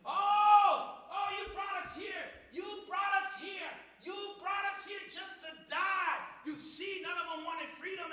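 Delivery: hammed-up complaining crowd shouting at Moses; complaining Israelites. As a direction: wail it like grumbling people. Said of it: "They start complaining".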